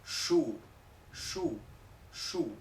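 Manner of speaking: "shoe" lento, con sh inglesa. Shown as a demonstration. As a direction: sopla la sh de "shoe". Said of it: "zapato en inglés, que es". ("shoe" con sh inglesa)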